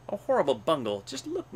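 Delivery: mild storybook groan, swooping up high; groaned complaint. A narrator's reading. Gnu surveys the tangled hair, groaning in mild dismay. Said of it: "groaned the gnu".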